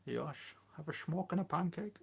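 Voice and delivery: comic mock-Dutch voice; bad Dutch accent. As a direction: put on a silly Dutch accent. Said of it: "that was a really bad Dutch accent".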